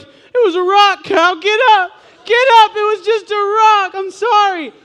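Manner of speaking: frantic, very high and loud pleading; panicked friend. Retold or imitated. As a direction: shriek at the cow to get up. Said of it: "He's like".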